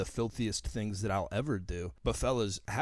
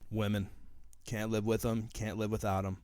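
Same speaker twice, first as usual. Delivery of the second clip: ordinary delivery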